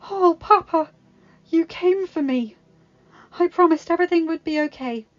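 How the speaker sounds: sniffling daughter, overjoyed; tearful relief. Acted. Belle embraces her father.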